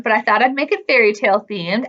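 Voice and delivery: plain reading voice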